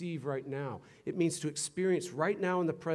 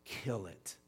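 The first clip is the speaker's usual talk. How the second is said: hushed emphasis; 11 dB quieter, slower than usual. Real, not acted. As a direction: drop to a near-whisper for weight. Preacher hushes for solemn emphasis.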